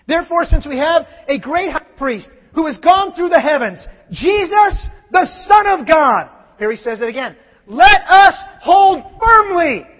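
loud, ringing pulpit delivery, Jesus and Son of God shouted; preaching at full force. Acted. Pastor proclaiming to the congregation, full-force preaching. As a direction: build it to a shout like a revival preacher.

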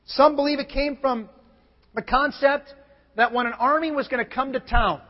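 normal talking voice

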